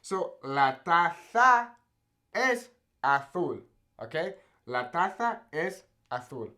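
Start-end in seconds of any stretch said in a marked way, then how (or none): 0.4-3.6 "La taza es azul" very slowly in Castilian Spanish, Z as a TH; Castilian Spanish: "taza" as tatha, "azul" as athul; Castilian Spanish lisp. Shown as a demonstration. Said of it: "that kind of lisp". 4.7-6.5 Castilian Spanish, "La taza es azul" with TH for each Z; "taza" as tatha and "azul" as athul, Spain style; Castilian Spanish lisp. Shown as a demonstration. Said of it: "it sounds kind of funny".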